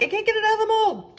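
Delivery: high, loud mock-frustrated customer voice. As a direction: play the exasperated baker who can't unmold. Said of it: "people that are like".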